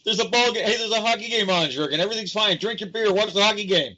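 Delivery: mock carefree sports fan. Host mocks the public to his co-host in a high carefree voice. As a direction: lull him like a dim couch potato.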